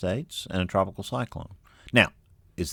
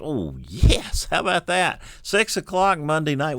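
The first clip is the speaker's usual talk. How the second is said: excited; 9 semitones higher, 5 dB louder than usual. Real, not acted. Excited at dew point map.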